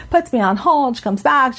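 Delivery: usual speaking voice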